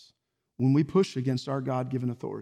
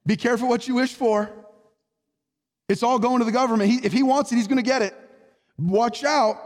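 raised, urgent preaching warning; fiery sermon voice; 8 semitones higher, 5 dB louder than usual. Acted. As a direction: drive the warning home from the pulpit.

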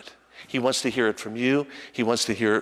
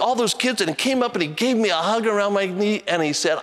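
usual speaking voice

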